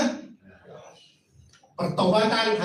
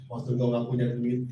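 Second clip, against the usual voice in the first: ordinary talk, nothing marked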